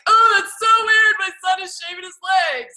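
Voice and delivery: high, loud mock outrage; a hammed-up, loud high-pitched fuss; mock freaked-out dad. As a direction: shriek it like a scandalized dad. Mocking 1979 dad freaking out.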